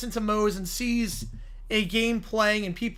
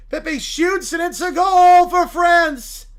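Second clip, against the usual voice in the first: ordinary delivery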